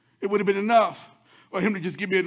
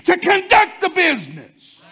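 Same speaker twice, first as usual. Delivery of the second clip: full-force preaching; 4 semitones higher, 6 dB louder than usual. Acted. Preacher lifting the church to a shout. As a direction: build the congregation up, hammering each phrase.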